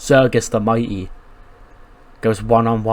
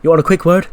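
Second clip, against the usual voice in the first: "quick" lifted higher and louder, mocking; mocking question; 4 semitones higher, 4 dB louder, faster than usual. Bivens mocks the interviewer, with a lift on "quick". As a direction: sneer the word "quick" back at him. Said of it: "Bivens is like".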